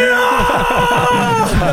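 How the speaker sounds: high triumphant Ja; victory shout. Real, not acted. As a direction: shout your win. Guessed right, cheering.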